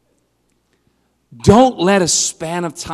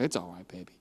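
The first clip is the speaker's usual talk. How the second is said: soothing; 10 semitones lower, 14 dB quieter, faster than usual. Retold or imitated. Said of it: "I was like".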